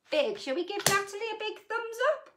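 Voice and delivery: usual speaking voice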